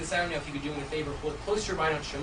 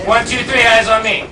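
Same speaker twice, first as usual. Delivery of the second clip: calling out loud; 4 semitones higher, 12 dB louder, slower than usual. Real, not acted. Calling class-style for attention.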